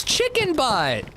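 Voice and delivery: put-on high, slow little-kid voice; toddler voice. Dad imitating daughter reading the mug, high slow toddler voice. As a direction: draw it out like a two-year-old. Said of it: "she points at it and goes".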